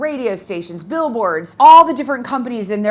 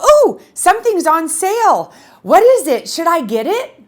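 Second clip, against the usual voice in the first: higher and louder, mock excited shopper; mock eager bargain hunter; 7 semitones higher, 6 dB louder than usual. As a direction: play up a greedy sale-hunter for laughs. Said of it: "People who go".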